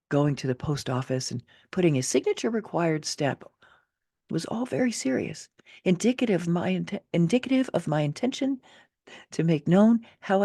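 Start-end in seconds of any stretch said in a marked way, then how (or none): none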